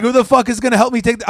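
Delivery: fast, high, loud mock rant; boss screaming. Boss ranting at the worker who soiled himself, fast mock yell. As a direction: rattle it off like a raging boss. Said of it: "he's screaming at".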